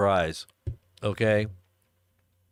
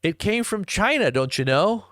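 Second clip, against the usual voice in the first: lightly put-on Canadian lilt, starting high; hint of a comic Canadian accent; mock Canadian accent; 7 semitones higher than usual. Host joking about the lost shirt, mock Canadian accent. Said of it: "the Canadians are gonna be like".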